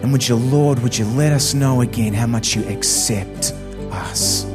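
ordinary talk, nothing marked

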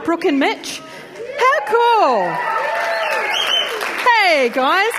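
usual speaking voice

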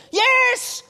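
high, loud, emphatic shout; excited child. Retold or imitated. Son insisting to doubtful dad, high excited shout. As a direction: confirm it like a thrilled kid.

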